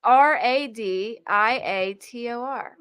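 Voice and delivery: slow letter-by-letter spelling; deliberate slow spelling. Shown as a demonstration. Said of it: "it's spelled rad".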